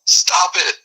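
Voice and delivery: high, sharp stop it; irritated outburst. Acted. Fed-up man snapping at the annoying person, high. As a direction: snap it out, pitch jumping up. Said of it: "veins in his forehead are popping out".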